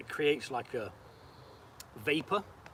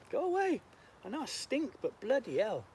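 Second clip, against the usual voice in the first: shooing flies; 7 semitones higher, 3 dB quieter than usual. Real, not acted.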